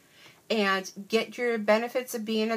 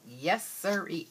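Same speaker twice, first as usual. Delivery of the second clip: ordinary talk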